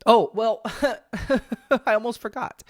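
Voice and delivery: high, bright laugh at her own slip; laughing at herself. Real, not acted. Podcaster laughing at her own forgetfulness. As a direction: laugh off a forgotten point. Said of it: "I'm just laughing at myself".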